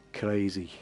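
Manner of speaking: ordinary talk, nothing marked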